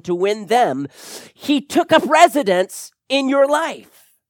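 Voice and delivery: fervent preaching. Acted. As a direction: proclaim it to the whole room. Preaching at full force.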